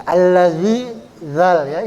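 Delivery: ordinary delivery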